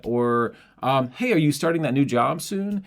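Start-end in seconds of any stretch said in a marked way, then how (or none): 1.1-2.8 higher, open, interested; curious friendly question. Shown as a demonstration. Asking a friend about the new job, curious. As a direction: ask like you really want to know. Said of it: "me being curious and interested in you".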